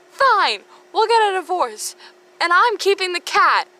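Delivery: high, loud, hammed-up huff; mock huffy divorce. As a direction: storm off from the marriage.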